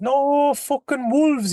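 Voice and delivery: high, slow, drawn-out punchline. Retold or imitated. Storyteller delivering the wolves punchline, high and slow. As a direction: stretch out the wolves line, pitched well up.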